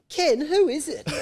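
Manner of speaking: mum calling out. Retold or imitated. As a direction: call out to Ken like a startled mum. Parent calling out at night.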